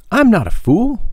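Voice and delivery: put-on high, injured boyish voice; indignant boy. A narrator's reading. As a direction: insist you're no fool, pitched high. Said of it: "Tom protesting to doctor".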